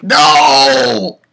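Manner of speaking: high, loud drawn-out no; dismayed shout. Retold or imitated. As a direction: bellow a long protest at the ending. Reenacts reaction to cliffhanger ending.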